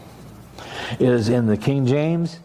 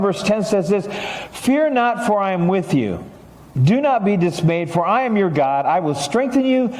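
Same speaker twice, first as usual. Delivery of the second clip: raised, slowing preacher's reading of the verses; scripture reading; 9 semitones higher than usual. Acted. Pastor reading Bible verses aloud to the church, lifted and solemn. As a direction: proclaim the verses to the congregation.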